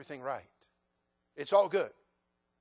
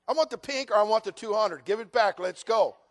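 loud, pushy mock demand for the money; hammed-up creditor insisting, loud; 4 semitones higher, 7 dB louder than usual.

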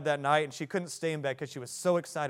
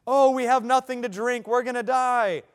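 high, loud panicked mock wail; whining Israelites; 9 semitones higher, 10 dB louder than usual. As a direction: grumble like thirsty, scared wanderers. Israelites complaining in the wilderness.

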